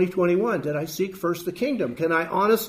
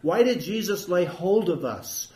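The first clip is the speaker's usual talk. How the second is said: ordinary talk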